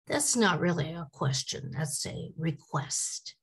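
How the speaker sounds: normal talking voice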